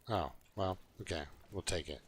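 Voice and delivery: ordinary talk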